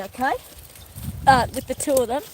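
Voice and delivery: ordinary talk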